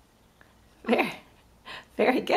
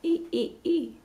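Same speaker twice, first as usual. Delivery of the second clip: high monkey squeals; monkey noise; 7 semitones higher, 5 dB quieter, faster than usual. Shown as a demonstration. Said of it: "What's a monkey say?".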